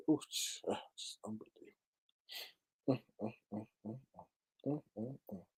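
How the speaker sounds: breathless, barely voiced dismay; stunned whisper. Real, not acted. Streamer reading a sick story, stunned whispering. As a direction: hush it out in disbelief.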